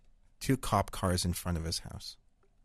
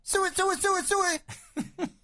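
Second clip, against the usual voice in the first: pig call; faster than usual. Retold or imitated. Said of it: "the farmer starts poking the pig, going".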